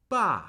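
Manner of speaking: Ba starting high, falling straight down; fourth tone on Ba. Shown as a demonstration. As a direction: drop "Ba" firmly, like a command. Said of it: "I'm starting higher".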